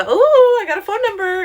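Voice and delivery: high, loud squeal of delight over a number; giddy ooh. Retold or imitated. To her coworker, bragging about the phone number, squealing high. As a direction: gush like you just scored a prize. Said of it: "Anyway, I go".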